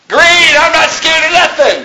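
high-pitched, playful bravado; mock defiance. Preacher jokes about repainting the threat sign, mock defiance. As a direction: act out a gleeful little vandal.

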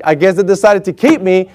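ordinary delivery

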